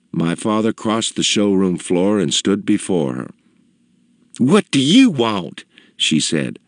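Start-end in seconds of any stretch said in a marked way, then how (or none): 4.4-5.6 high, slow disdainful matron; snubbing woman, drawn out; haughty customer. A narrator's reading. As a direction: challenge him haughtily in a raised pitch. Customer snubs the father.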